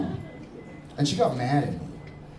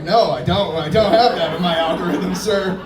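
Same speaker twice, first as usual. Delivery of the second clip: louder, indignant denial; mock loud retort; 4 semitones higher, 8 dB louder than usual. Comic answering a guy about his Instagram, mock loud denial. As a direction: push back loud at the question.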